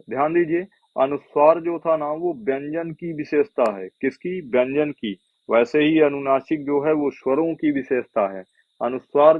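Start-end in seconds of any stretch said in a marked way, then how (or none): none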